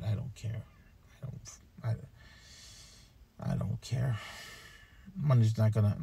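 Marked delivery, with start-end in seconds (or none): 0.0-4.2 softer, resigned murmur; defeated and quiet. Real, not acted. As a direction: let it drop away, defeated. Despairing after leaving trip.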